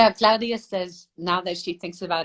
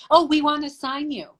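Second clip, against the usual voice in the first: lightly mimicked agency, pitched higher; agency's offer; 7 semitones higher than usual. Agency offering the model a contract, lightly mimicked. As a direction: put on a higher voice to quote the agency.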